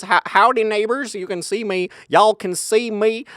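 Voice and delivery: high-pitched, drawn-out Southern twang; mock Southern accent. Calling to the neighbors through open windows, mock Southern accent. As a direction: ham up a Southern accent, stretching the last words. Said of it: "went all that accent".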